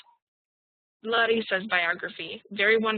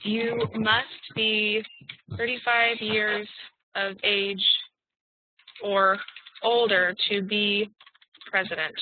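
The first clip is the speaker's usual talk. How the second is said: very slow, drawn-out dictation; much slower than usual. Shown as a demonstration. Teacher dictating while writing in the chat. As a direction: stretch the words as you type. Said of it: "And I'll write another example".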